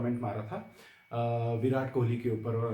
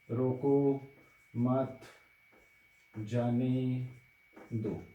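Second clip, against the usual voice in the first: रोको... मत... जाने... दो, each word alone with long pauses; much slower than usual. Shown as a demonstration. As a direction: recite it slowly, a long gap after every word. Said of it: "you must have heard this one".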